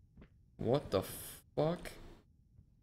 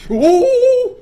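very high, very loud shriek; startled scream; 19 dB louder than usual. Real, not acted. Streamer hit by a jump scare, shrieking.

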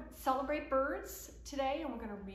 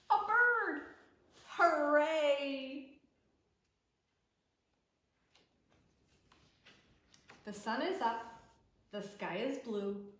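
lively picture-book reading, calls of yes and hooray up high; reading to children; 3 semitones higher, 9 dB louder than usual. Acted. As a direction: read it to little ones at story time. Reads picture book aloud.

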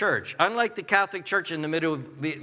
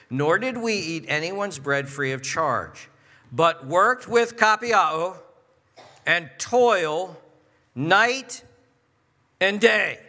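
slow, punched-out Bible reading with weighty pauses; emphatic scripture reading; 3 dB louder, slower than usual. Acted. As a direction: let each phrase land hard, word by word. Reads scripture aloud emphatically.

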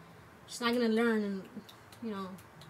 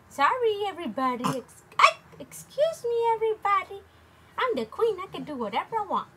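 put-on high, loud baby voice; voicing the baby; 8 semitones higher, 8 dB louder than usual. Speaking as the bossy baby queen in high voice.

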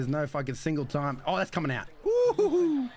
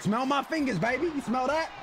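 mock Travis Kelce; 7 semitones higher than usual. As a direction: hype it up like a swaggering tight end. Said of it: "Travis is like".

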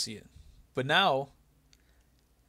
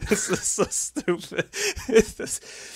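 laughing helplessly through the words; can barely get it out for laughing; cracking up at a stupid wrestling bit; 8 semitones higher, 3 dB louder, much slower than usual. Real, not acted. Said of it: "I fucking laughed my ass off".

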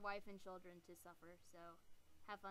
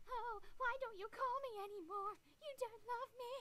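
put-on high, far, whiny old grandma voice; mock needy guilt-tripping grandma; grandma impression; 11 semitones higher, 3 dB louder than usual. Teen mocking grandma who wants calls, high whiny voice. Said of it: "She's like".

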